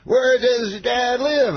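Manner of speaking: high, slow, drawn-out question; Bill Cosby. Retold or imitated. Star asks young fan about his dad, Bill Cosby impression. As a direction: imitate a star comic grandly taking an interest. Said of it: "He goes".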